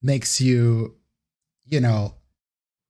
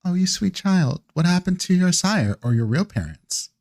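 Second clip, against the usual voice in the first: higher, cooing sympathy; tenderly condescending, pitched up; pitying vampire elder; 6 semitones higher than usual. Acted. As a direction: coo over a poor sweet child. Vampire elder pities the player character.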